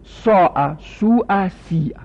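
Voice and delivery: ساعه، سوعه، سیعه with the hamza pronounced; Arabic example words. Shown as a demonstration. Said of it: "مثلاً ببینا".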